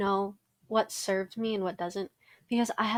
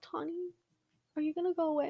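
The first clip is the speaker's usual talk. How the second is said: higher, softer imitating a worried little brother; little brother asking; 8 semitones higher, 5 dB quieter, faster than usual. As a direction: ask it small and unsure, like a kid. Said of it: "and he's like".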